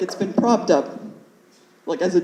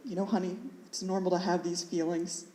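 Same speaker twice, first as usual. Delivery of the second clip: very soft, reassuring mother; gently calm a nervous child, voice hushed; soothing mom; 13 dB quieter than usual. Retold or imitated. Mom reassuring her nervous child before the lesson, softly soothing.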